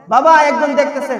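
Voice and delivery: put-on a boy shouting to warn his father; calling out. Son calls out to father.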